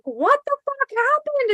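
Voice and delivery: high frantic alarm; panicked shout. Retold or imitated. To bleeding Kylie in the field, a high panicked cry. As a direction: shriek it in a panic at the bleeding friend.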